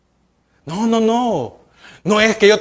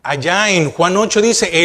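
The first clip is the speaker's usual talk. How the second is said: ordinary delivery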